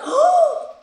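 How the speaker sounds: high, loud mock-shocked "oh"; shocked gasp. Teacher hearing the prank question, mock shocked gasp.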